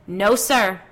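sudden, forceful shout; loud. A narrator's reading. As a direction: burst out loudly enough to startle. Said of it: "Judith said loudly".

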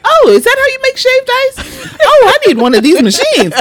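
high excited wonder; fascinated. Retold or imitated. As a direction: gush over it in a high voice.

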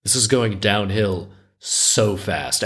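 ordinary delivery, nothing marked

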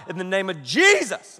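Jesus stretched and swooping upward; hard praying. Retold or imitated. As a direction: punch the word Jesus in a mock-fervent prayer. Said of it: "that hard".

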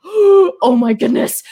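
slow, loud, high gasped dismay; embarrassed shock. Retold or imitated. Realizing her boss heard her singing, loud and slow. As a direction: drag it out in horrified realization.

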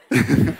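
a quick bright laugh; short laugh. Real, not acted. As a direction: laugh at the punchline. Laughing at his food joke.

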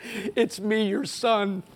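a hint of an anxious pleading son, high and loud; mock begging for a father's approval. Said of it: "come running up to my dad and saying".

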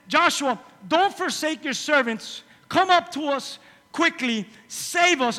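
slow, high desperate plea; pleading. Acted. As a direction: beg Joshua for rescue. Voicing the men of Gibeon pleading.

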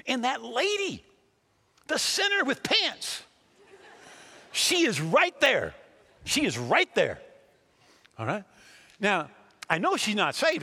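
loud, high-pitched pulpit preaching; full-force preaching. Real, not acted. Preacher explains who can receive, loud emphatic preaching. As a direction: preach it at the top of your range.

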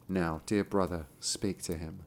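low, soft invitation to the brother; quiet. A narrator's reading. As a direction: murmur it gently to the brother. Same line reread differently later.

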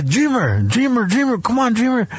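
high, urging chant of his name; hyping up a friend to rap; excited hype. Retold or imitated. Drunk guy urging his buddy Dreamer to rap, high excited chant.

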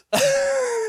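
high, loud burst of laughter. Real, not acted. Laughing after retelling the rant, high and loud. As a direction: laugh hard at the memory.

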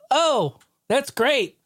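high, slow, mocking whine; a hammed-up high sarcastic sulk; sarcastic Virtual Boy. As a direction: stretch it out high with bitter sarcasm. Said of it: "Virtual Boy's like".

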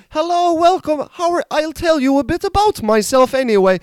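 high-pitched Polish accent welcoming an audience; Polish character voice. Shown as a demonstration. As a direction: greet the crowd as a Polish guy in a high voice. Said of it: "with this Polish character".

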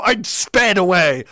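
usual speaking voice

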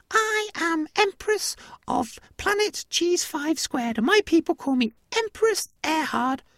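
high-pitched regal mouse; mouse empress. Acted. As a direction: announce it grandly in a tiny mouse voice. Mouse empress introduces itself.

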